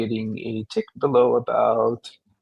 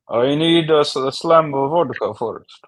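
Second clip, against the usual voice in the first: mock Russian, pitched up and slow; Russian accent; 4 semitones higher, 4 dB louder, slower than usual. Playing Russian for the hosts, mock Russian accent. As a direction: play a vodka-loving Russian. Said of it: "Oh, I get to be Russian".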